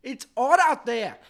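high, loud mock Australian accent; hammed-up, emphatic Australian exclaiming. Australian passenger pulls his arm back in, loud mock Australian accent. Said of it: "he pulls his arm back and he says".

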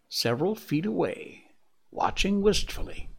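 ordinary delivery, nothing marked

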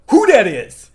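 high, loud yelled catchphrase; mock yell. Imitating the quiet kid interrupting class, loud high yell. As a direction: yell it out of nowhere. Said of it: "this quiet kid would yell".

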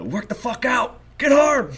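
high, hammed-up drill yell; yelling at himself. Runner to himself on a morning run, self-motivating yell. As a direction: re-enact psyching yourself up at full pitch. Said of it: "I yell that shit to myself".

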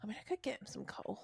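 hushed joking whisper; whispered aside. Real, not acted. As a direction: whisper it like a naughty secret. Joking aside about giving coal.

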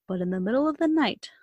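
plain reading voice

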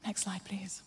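whispered cue to the tech; a soft unvoiced request. Real, not acted. Preacher quietly asking Elspeth for the next slide, whispered.

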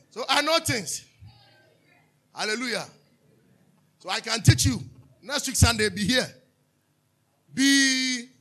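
loud, high preaching burst; preaching at full force. Acted. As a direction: drive it home to the congregation.

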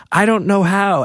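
put-on high, protesting kid's voice; helpless kid brother. Brother admitting helplessness to dad, high and pleading. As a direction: imitate a boy at a loss.

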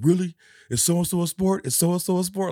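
loud, high imitation of a heated arguer; acting out a worked-up cousin; mock indignation. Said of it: "mad about it".

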